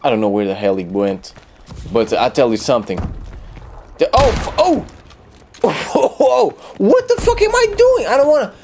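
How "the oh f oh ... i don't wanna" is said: very high, laughing panic; squealing surprise, then a laugh; shocked panic. Real, not acted. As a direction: caught off guard by his own mistake. Pressing the wrong button.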